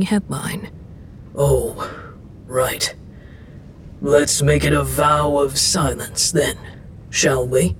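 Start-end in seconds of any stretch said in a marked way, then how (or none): 1.3-7.7 deep deity voice, backing down; god relenting. Acted. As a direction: concede it in a low godly register. God relents after seeing newspaper.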